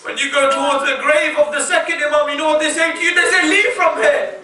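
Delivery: fervent mourning sermon voice; impassioned preaching. Acted. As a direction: pour grief into it, rising high.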